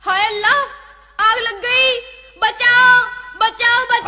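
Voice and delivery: loud, panicked cries; desperate loud call for rescue; screaming for help. Acted. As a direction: shout the alarm to the whole street. Wife cries for help in fire.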